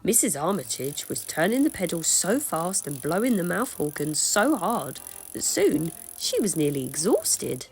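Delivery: plain reading voice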